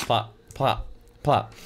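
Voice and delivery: plop plop plop rattled off fast; quick plops. Shown as a demonstration. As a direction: run the three plops together. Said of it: "It says plop three times".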